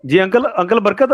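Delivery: ordinary talk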